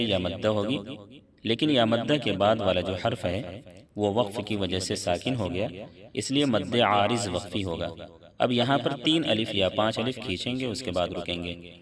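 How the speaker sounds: ordinary talk, nothing marked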